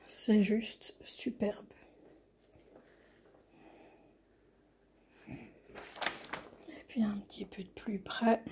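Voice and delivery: slow, hushed whisper; whispered awe. Real, not acted. Whispers while filming the canvas. (chuchoté lentement, émerveillée)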